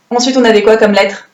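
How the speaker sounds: normal talking voice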